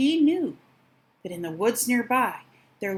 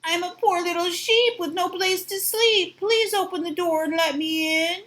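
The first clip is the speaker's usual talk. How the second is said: high sugary wolf in disguise; sweet little voice; 8 semitones higher than usual. Acted. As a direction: sweeten your voice to trick them. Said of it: "in a sweet little voice".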